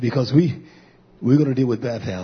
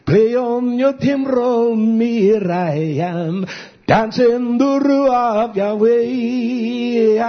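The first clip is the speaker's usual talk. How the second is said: preaching at full force; 9 semitones higher, 4 dB louder, much slower than usual. Acted.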